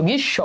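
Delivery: গ্রীষ্ম as "grish-sho"; doubled sh. Shown as a demonstration. Teacher letting students hear it, গ্রীষ্ম with doubled sh. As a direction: split গ্রীষ্ম on a long sh, no m. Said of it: "শয়ের উচ্চারণ দুইবার পাচ্ছ".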